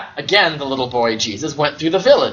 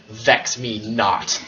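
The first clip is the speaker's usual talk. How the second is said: lower, very slow and soft, grave command; mock-solemn deity voice; ominous warning; 6 semitones lower, 5 dB quieter, much slower than usual. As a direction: draw it out like a divine threat. Child Jesus warns his father.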